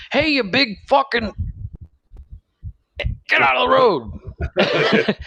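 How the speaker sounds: high mock yell, laughing through it; dad yelling out the window. Dad yelling at a driver in traffic, high laughing yell. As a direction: bellow it out the van window, cracking up. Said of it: "My dad yells out the window".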